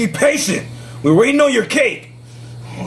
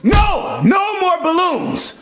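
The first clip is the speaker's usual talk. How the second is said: high sharp yell; shouting; 7 semitones higher, slower than usual. Acted. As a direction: shout him down. Yelling at Toad.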